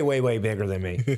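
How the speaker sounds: ordinary delivery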